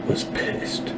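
whispered, dropping to a breath; hushed whisper. Shown as a demonstration. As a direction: say it under your breath for effect. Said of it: "repeats in a hushed whisper".

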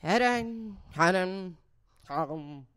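lightly mocked actress delivering a monologue; garbled, drawn-out mock of an actress; mock a feeble dramatic monologue in meows; Kristen Stewart. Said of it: "she just was like".

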